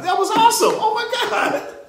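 high squeal breaking into laughter; overjoyed outburst. Real, not acted. Preacher thrilled by her sister's book, overjoyed squeal. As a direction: burst out squealing in amazed delight. Sudden outburst over the poem.

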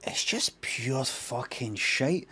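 slow, soft, drawn-out verdict; hushed, deliberate dismissal; quiet disgust. Real, not acted. Deadpan verdict on the trailer.

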